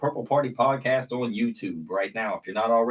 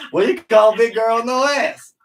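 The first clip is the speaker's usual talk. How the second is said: loud, high retold joke setup; excited joke delivery; 12 semitones higher, 10 dB louder than usual. Comic retelling how he invented his joke, loud and high. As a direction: belt out the question, pitched way up.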